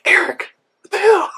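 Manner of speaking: exasperated at a useless friend; a squeaky incredulous complaint. Retold or imitated.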